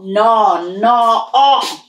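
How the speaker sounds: "no" repeated then "o" rising; teacher demo; sounding out no. Shown as a demonstration. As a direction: spell it by sound. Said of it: "choose the no".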